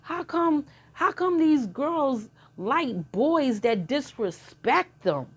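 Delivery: put-on hurt, high, slow question of a boy; upset son. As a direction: ask it like a wounded young son, drawn out and high. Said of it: "he was really upset".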